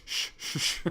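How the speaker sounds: teasing copy of her soft unvoiced shh; imitating her shush. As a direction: mimic the shushing lightly. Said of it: "you're supposed to go shh".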